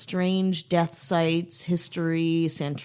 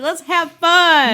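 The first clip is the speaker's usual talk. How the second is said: cheerful; 9 semitones higher, 8 dB louder, much slower than usual. Retold or imitated. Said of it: "I'm always like".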